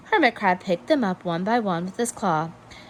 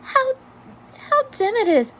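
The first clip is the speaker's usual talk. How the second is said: usual speaking voice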